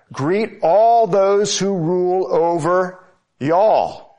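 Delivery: deliberate build to an emphatic "y'all"; stress on y'all. Shown as a demonstration. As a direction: hold back, then hit "y'all" with a falling swoop. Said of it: "something special about the Southern dialect".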